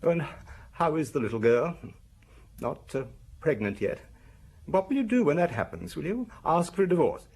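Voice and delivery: wife sneering at husband over his young mistress, mocking; mocking wife's taunt. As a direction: needle him with scorn about the girl. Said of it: "later she asked".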